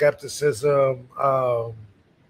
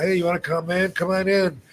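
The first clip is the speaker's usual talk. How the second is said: higher-pitched eager invitation; retold excitement; 6 semitones higher than usual. Excited fan inviting a visitor into his house, retold excitement. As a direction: imitate an excited fan inviting someone inside. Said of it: "he was all excited".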